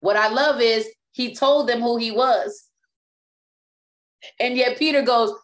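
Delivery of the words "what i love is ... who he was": ordinary talk, nothing marked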